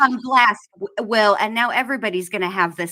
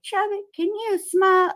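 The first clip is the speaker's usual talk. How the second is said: high mock mama voice; 10 semitones higher than usual. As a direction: play the mom sweetly up high. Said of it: "One day my mama said".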